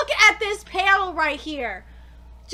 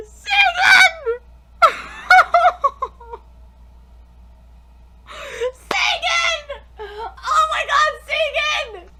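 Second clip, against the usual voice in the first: high, loud tearful cry; 5 semitones higher, 5 dB louder, slower than usual. Real, not acted. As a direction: call out the name through sobs. Crying out character's name.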